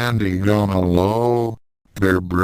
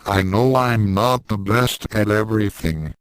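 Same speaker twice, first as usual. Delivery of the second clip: usual speaking voice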